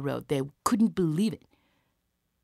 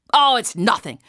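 brushing it off; 7 semitones higher, 9 dB louder than usual. Acted. Soldier brushes off the question.

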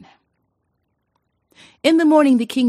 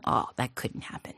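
whispered aside; 9 dB quieter than usual. A narrator's reading. King's dismayed inner thought.

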